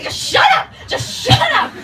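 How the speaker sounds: high, loud mock fury; exasperated scream. Daughter silencing rambling mom, hammed-up screaming.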